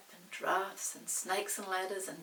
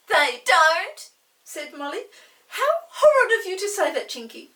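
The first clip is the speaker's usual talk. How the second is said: high, loud wounded protest; hurt girl; 10 semitones higher, 18 dB louder than usual. Acted. Molly protests to Chinky, high and hurt. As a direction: protest like an offended little girl.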